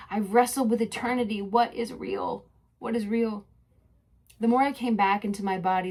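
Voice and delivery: usual speaking voice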